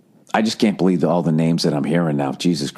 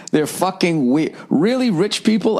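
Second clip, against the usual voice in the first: weird out; 9 semitones higher than usual. Real, not acted. Disgusted verdict on rich people.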